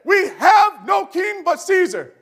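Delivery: high, slow mob shout; crowd shouting. Acted. Preacher voicing the crowd rejecting Jesus, shouting. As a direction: cry it out as the crowd. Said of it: "we read it".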